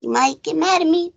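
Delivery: ordinary delivery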